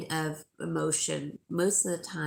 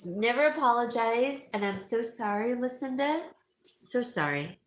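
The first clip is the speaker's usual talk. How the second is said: normal talking voice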